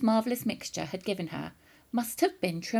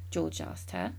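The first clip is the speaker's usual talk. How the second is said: ordinary delivery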